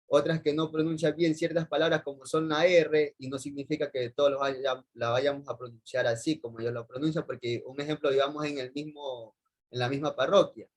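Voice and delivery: usual speaking voice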